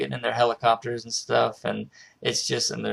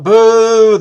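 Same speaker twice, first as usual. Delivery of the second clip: loud, much higher jeering boo; mock booing; 12 semitones higher, 17 dB louder than usual. Seals jeering the new guy, loud mock boo. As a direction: boo him off the stage.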